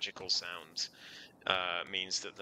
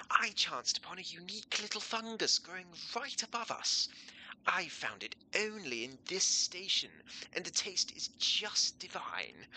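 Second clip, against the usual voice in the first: high, theatrical posh voice; swooping, high-society posh character; posh accent; 8 semitones higher than usual. Acted. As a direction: savour it in an exaggerated posh accent, slowing at the end. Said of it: "more of a posh accent".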